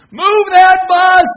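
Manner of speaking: drawn-out, high, full-volume host shout; booming shout. Retold or imitated. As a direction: bellow it slowly to a huge crowd. Imitates host's big on-show command.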